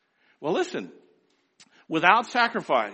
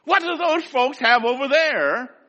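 amazed shout; 7 semitones higher, 7 dB louder, slower than usual. Retold or imitated. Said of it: "And the world will say".

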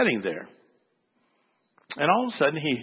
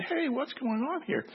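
indignant protest; 11 semitones higher, 4 dB quieter than usual. Retold or imitated. Waiting man protests boy cutting in.

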